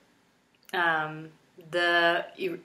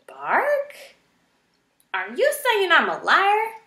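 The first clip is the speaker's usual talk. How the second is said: high, louder outraged roar; porcupine roaring; 13 semitones higher, 5 dB louder than usual. A narrator's reading. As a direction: roar as if insulted. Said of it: "the porcupine roared".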